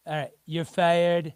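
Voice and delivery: slow, lightly voiced Scottish Trump. Radio host put on the spot by co-host, half-hearted Scottish Trump. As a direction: attempt a slow Trump catchphrase with a Scottish lilt. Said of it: "Scottish Trump impersonation".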